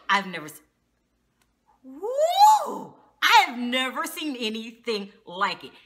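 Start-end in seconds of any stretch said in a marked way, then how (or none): 1.8-2.9 high, loud whooping cry; shocked woo. Real, not acted. Reacting to the episode, high loud whoop. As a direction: let out a stunned whoop. Exclaims in disbelief.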